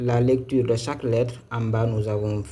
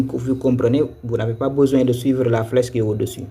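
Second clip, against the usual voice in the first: ordinary talk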